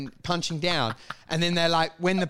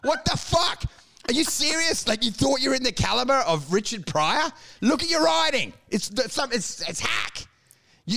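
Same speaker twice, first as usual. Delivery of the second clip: mock rant; 3 semitones higher than usual. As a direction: tear into him, pitch climbing. Ranting at the comic.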